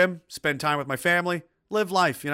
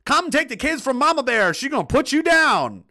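defiant shout; 11 semitones higher, 6 dB louder than usual. Pretend. Mama Bear defending her kids.

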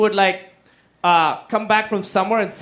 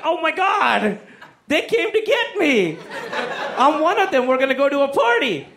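much higher, thrilled squeal-like gush; giddy excitement; 8 semitones higher than usual. Retold or imitated. Teen seeing the cool kids' car pull up, in a much higher giddy voice. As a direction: gush like a kid who thinks he's finally in. Thinks cool kids came for him.

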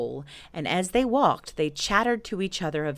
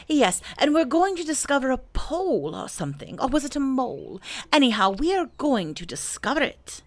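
higher, muddled but eager voice; lightly voiced Pooh; 8 semitones higher than usual. Pooh tells Rabbit about the pole, lightly voiced and eager. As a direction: explain importantly, lightly in a bear's character.

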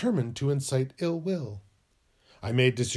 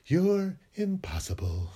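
ordinary talk

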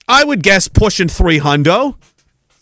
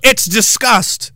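ordinary talk, nothing marked

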